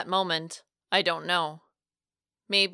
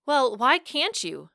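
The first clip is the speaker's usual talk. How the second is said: higher, challenging question with swooping pitch; 6 semitones higher than usual. A narrator's reading. Narrator refusing to give up on Franco, challenging. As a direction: throw it back as a challenge. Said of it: "I challenged".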